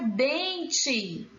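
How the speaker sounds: "dente" slowly stretched out; drawn-out syllables. Shown as a demonstration. Teacher asking for the tonic syllable, "dente" drawn out. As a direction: draw out each syllable of "dente". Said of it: "Qual é a sílaba tônica?".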